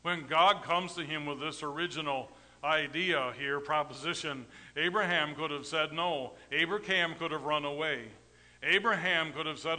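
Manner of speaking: ordinary delivery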